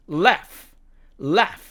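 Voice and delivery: American "laugh", front a; American demo of the word "laugh"; American accent. Shown as a demonstration. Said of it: "미국식 발음으로는".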